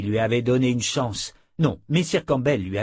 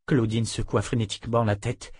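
ordinary delivery

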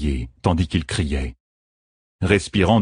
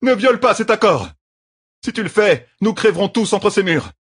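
higher, louder desperate warning; urgent shout; 3 dB louder than usual. A narrator's reading. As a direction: plead hard, voice straining. Matt urgently stops Noal.

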